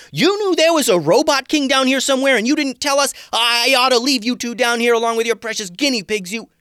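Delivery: high, loud, fast furious rant; full-on angry. A narrator's reading. Tatiana raging at the two pet shop owners, furious shouting rant. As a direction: tear into them, furious. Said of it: "full-on angry now".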